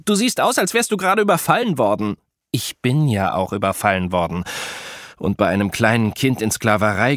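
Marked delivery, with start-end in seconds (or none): 0.0-2.2 Mac teasing. A narrator's reading. Mac mocks Apollo's looks.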